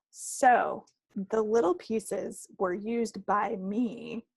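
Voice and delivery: ordinary talk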